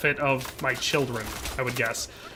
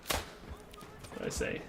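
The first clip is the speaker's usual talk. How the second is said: whisper; 18 dB quieter, much slower than usual. Real, not acted. Muttering to himself mid-game.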